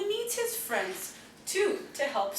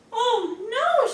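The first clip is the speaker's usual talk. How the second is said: higher, louder startled cry; alarmed cry; 9 semitones higher, 6 dB louder than usual. Acted. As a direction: cry out at the sight. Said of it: "she cried".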